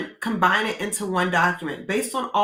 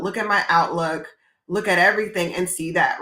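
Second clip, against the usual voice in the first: usual speaking voice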